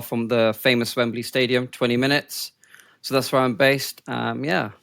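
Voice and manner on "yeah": ordinary talk, nothing marked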